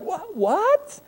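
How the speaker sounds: high, startled double what; confused what. Retold or imitated. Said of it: "And you're like".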